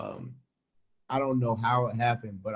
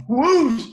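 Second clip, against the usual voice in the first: whoosh; 12 semitones higher, 9 dB louder than usual. Retold or imitated. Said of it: "She said".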